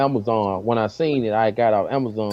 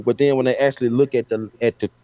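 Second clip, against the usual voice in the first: ordinary talk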